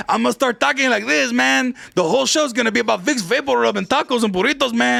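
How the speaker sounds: put-on East LA Chicano accent; Cheech-style East LA accent. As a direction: do a mock East LA stoner accent. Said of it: "that is the East L A accent because it's Cheech".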